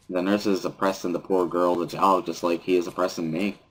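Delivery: usual speaking voice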